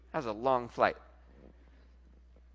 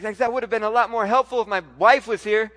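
higher, much louder comeback; exasperated retort; 6 semitones higher, 11 dB louder than usual. Retold or imitated. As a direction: protest it loudly, half in jest. Retold exasperated reply to lawyer.

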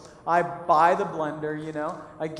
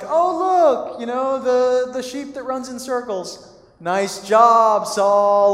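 loud, high mock praise dripping with sarcasm; hammed-up fake congratulations; sarcastic mockery; 5 semitones higher, 10 dB louder, slower than usual. Samuel mocking Saul's offering, loud and sarcastic. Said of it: "a little sarcasm in his voice".

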